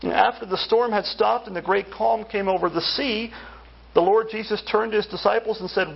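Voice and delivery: usual speaking voice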